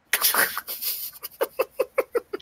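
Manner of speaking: high, wheezing laugh; real breathless cackle; helpless laughter. Real, not acted. Finder cracks up at the wiki discovery, helpless laughter. Reacting to the found movie.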